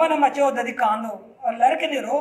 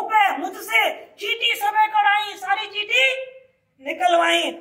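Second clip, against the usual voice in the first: put-on high, aggrieved child's complaint; child Krishna, high and indignant; child Krishna protesting; 7 semitones higher than usual. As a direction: whine it like a wronged little boy.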